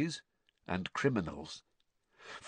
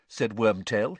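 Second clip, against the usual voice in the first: usual speaking voice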